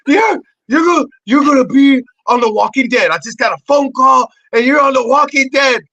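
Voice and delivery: high, loud, gushing excitement; excited agent. Retold or imitated. Agent telling him he's on The Walking Dead, loud and thrilled. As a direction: blurt the big news. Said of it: "She goes".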